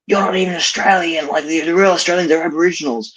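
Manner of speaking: high, mocking voice of the critics; mocking quote. S0 mimicking ethnic groups who deny he is Australian, high mocking voice. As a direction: sneer it back as their accusation. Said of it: "ethnic groups saying".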